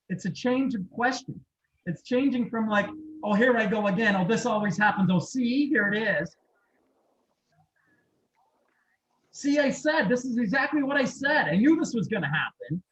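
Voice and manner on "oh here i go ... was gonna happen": exasperated, sighing inner complaint, a bit louder; mock the nagging voice in your head; whiny self-talk. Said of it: "listen to how you're talking".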